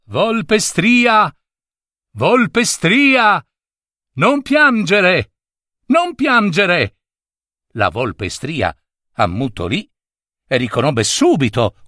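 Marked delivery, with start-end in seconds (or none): 0.0-7.0 higher, louder, slow call across the distance; the dog calling the caged fox, loud and slow. Acted. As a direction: call her name so she hears from afar. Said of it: "la chiamasse a voce alta".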